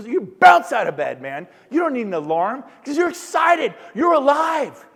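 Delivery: fired-up shout at the crowd; excited shout. Real, not acted. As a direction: yell it like you're waking the room up. Pumping up the seminar crowd.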